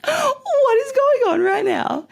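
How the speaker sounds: very high, drawn-out squeal; mock disbelief. Caught in the downpour by the car, high incredulous squeal. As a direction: wail it high and slow, half laughing. Retold disbelief at sudden rain.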